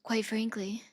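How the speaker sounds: soft, breathy attempt at Trump; half-hearted whispery Trump try; failed Trump voice. Shown as a demonstration. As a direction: try a quiet, unconvincing Trump impression. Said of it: "cannot do a Trump voice".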